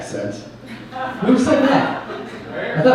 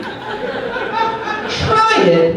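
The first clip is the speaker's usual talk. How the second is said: mock Hollywood line. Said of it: "industry speak".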